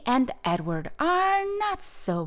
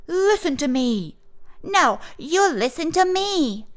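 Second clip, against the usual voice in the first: put-on high, loud childish bickering; arguing; 7 semitones higher, 4 dB louder than usual. A narrator's reading. Two children squabbling over lunch, argumentative. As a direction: trade insistent demands, the reply higher. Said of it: "Flossie and Edward argued".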